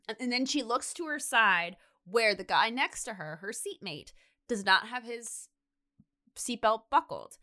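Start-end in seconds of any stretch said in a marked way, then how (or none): none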